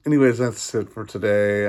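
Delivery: usual speaking voice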